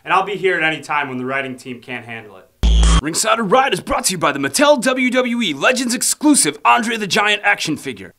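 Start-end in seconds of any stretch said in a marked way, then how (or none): 3.0-8.1 upbeat announcer ad voice, higher and louder; sponsor read. Acted. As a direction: sell it like a radio spot. Sponsor read over music.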